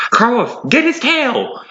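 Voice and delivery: high, slow shouted order; calling out. A narrator's reading. Astrid telling Carlos to bite the tail, high call. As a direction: call it across the fight. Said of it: "shouted Astrid".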